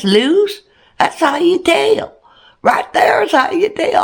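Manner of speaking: a hint of Bubba teaching her how to tell; quoting Bubba. Wife quoting husband Bubba explaining deer antlers, lightly voiced.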